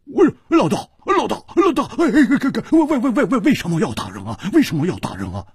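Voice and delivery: high-pitched, stammering yelp of a beaten man; hoarse cry. A narrator's reading. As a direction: cry out in strangled, stuttering protest. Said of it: "他嘶声说".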